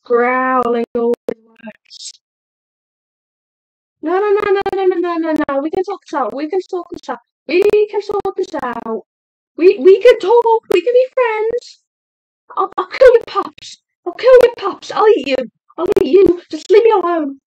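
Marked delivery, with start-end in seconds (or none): 4.0-5.6 rapid high loud nos; panicked no no no; frantic pleading. Pretend. Pleading with attacking wolves in game. 5.6-9.0 higher, urgent appeal; bargaining panic; mock pleading. Pleading with wolves. 9.6-17.4 high, loud desperate threats; panicked shouting; frantic yelling. Pretend.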